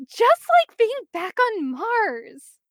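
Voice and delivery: high, slow, happy lilt; brightly cheerful. A narrator's reading. Megan reminded of home on Mars, high and cheerful. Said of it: "And Megan brightens".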